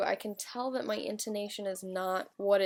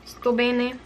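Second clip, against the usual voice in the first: reading a journal entry in Italian; 3 semitones higher, 7 dB louder than usual. Acted. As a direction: read your Italian diary aloud, hesitant and careful. Reads Italian journal entry aloud.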